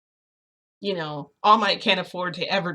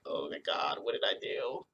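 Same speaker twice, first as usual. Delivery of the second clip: very low, hushed oh-my-God; quiet, low dismayed murmur; dread; 9 dB quieter than usual. Retold or imitated. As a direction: sink far down in pitch and volume, aghast. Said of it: "now I'm kind of like".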